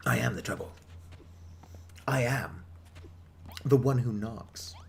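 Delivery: low, slow menacing reply; menacing quote. Acted. Player answering the barkeep, mock menacing. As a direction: deliver it like a grim TV antihero. Quoting a famous TV line.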